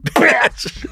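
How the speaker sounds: high-pitched drawn-out shout; hammed-up insult yell; mock yelling. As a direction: cry it out high, playing angry.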